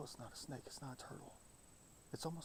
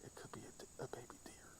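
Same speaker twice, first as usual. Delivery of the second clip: quiet unvoiced whisper; 9 dB quieter than usual. Real, not acted. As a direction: whisper it under your breath. Hushed while watching wildlife.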